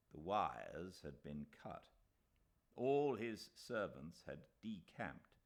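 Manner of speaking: plain reading voice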